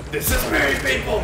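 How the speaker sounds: high proud yell; shouting. Real, not acted. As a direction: proclaim it loudly to everyone. I just wanted to do that.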